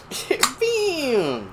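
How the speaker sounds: high, drawn-out mock call, New York accent; mom's New York accent. Son mimicking his mom calling him, high New York accent. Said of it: "it's better than my mom's accent".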